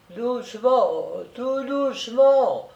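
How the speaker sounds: high, slow, soothing murmur; murmuring softly. Acted. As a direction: hush her gently, drawn out. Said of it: "murmura Madeleine".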